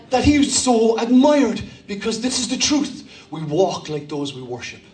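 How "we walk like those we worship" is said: solemn, quiet preacher's refrain; low, hushed emphasis. Real, not acted. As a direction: drop it down and let it land gently. Sermon's key line, dropped low.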